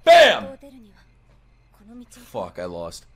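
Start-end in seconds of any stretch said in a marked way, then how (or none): 0.0-0.5 high, sharply falling shout; loud exclamation. Real, not acted. Viewer calling the moment after a countdown, loud exclamation. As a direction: call out the hit loudly.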